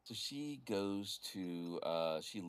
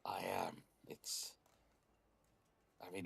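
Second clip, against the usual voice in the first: normal talking voice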